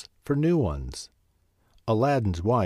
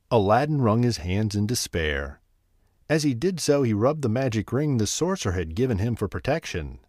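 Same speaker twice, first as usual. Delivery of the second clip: plain reading voice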